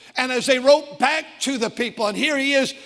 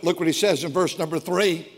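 ordinary talk

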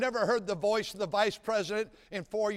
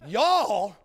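drawled Southern y'all; 5 dB louder than usual. Retold or imitated. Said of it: "They say".

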